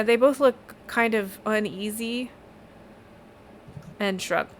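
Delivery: ordinary talk, nothing marked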